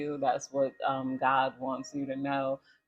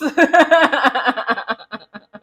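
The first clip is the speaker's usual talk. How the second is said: loud laugh; 10 semitones higher, 14 dB louder than usual. Real, not acted. Laughs about sharing story.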